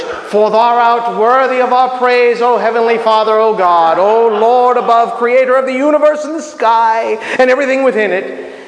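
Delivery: grandiose parody of formal praying; mock Old English prayer. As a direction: roll out lofty titles with pomp. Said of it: "we start breaking into the Old English".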